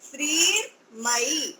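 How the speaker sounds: श्रीमयी said very slowly in two parts, श्रीर् then मयी; teacher splitting श्रीमयी in two; split into two parts. Shown as a demonstration. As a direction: break श्रीमयी in two for the learner. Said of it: "ऐसा बोलिए".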